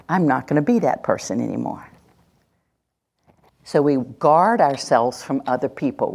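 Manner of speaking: ordinary talk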